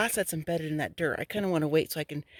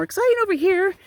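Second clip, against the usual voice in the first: excited; 14 semitones higher, 7 dB louder than usual. Real, not acted. Hyped announcement.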